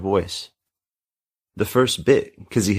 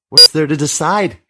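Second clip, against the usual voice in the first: outburst; 9 semitones higher, 9 dB louder than usual. Acted. Kizzy defying her mother's threat.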